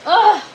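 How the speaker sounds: a high, loud, fed-up "ugh"; frustrated groan. Real, not acted. Streamer fed up with her broken grow setup, loud groan. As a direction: groan out the annoyance. Venting about everything going wrong.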